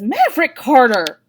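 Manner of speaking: scolding full name. A narrator's reading. Wife scolding husband in front of kids, scolding. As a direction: snap his full name in warning.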